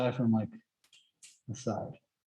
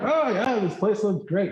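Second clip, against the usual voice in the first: high, bright and delighted; excited character voice; 8 semitones higher, 4 dB louder than usual. Retold or imitated. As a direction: gush over the view. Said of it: "And we're like".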